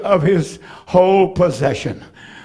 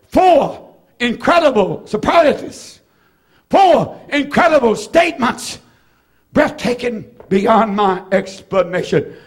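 ordinary talk

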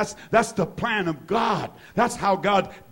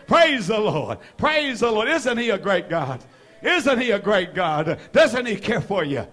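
emphatic preacher's call to worship; rousing praise; 5 semitones higher, 3 dB louder than usual. Real, not acted. Preacher rousing the congregation to praise, fervent. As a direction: fire up the room, phrase after phrase.

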